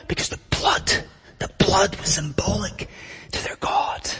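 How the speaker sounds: a hushed sacred whisper; reverent whisper. Acted. Preacher drops to reverent hush.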